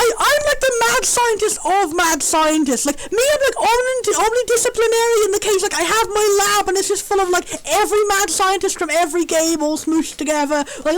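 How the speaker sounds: ordinary delivery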